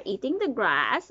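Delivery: plain reading voice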